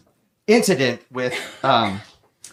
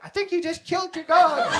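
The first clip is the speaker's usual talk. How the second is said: put-on much higher-pitched girl's voice; girl bystander; 13 semitones higher than usual. Girl behind the dog's owner breaking bad news, mimicked high. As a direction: imitate an onlooker in a high voice. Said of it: "I hear this girl behind me".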